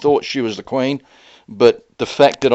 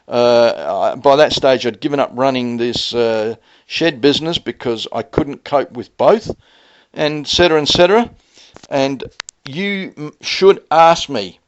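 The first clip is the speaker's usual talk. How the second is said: usual speaking voice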